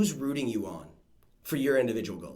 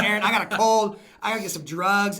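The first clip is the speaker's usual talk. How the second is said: whiny sick excuse; 6 semitones higher, 6 dB louder than usual. Retold or imitated. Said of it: "I was like".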